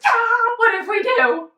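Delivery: high, loud excited squeal; thrilled squeal. Retold or imitated. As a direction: burst out giddy at the thought. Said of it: "I'm like".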